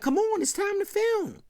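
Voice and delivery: high-pitched imitation of a summons; mock-urgent high call to work. Belding calls them to film.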